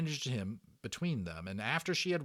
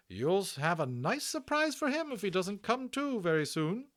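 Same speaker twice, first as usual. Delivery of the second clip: high loud brisk nurse voice; a bustling hospital matron; hearty Matron; 12 semitones higher, 6 dB louder than usual. Acted. Matron reassuring Omri about the Indian, high and hearty.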